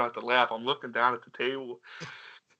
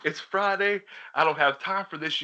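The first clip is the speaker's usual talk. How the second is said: higher, mock-exasperated brush-off; retold impatience, pitched up; exasperated dad; 7 semitones higher than usual. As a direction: imitate a fed-up dad on the phone. Acts exasperated dad on prank call.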